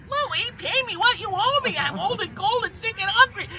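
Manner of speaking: put-on high little old lady voice; frail old widow pleading, high; elderly singsong. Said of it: "hear Missus Fontenot's voice".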